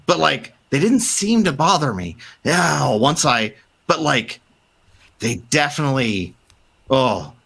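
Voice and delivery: high, halting and tearful; a doctor telling how he survived; scared survivor. Acted. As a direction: stumble through it half crying. NPC frightened account.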